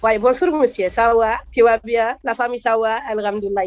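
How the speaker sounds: put-on light Malian accent greeting warmly. Comedian demoing her Malian accent for the host, lightly. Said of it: "Et ça, c'est les Mali".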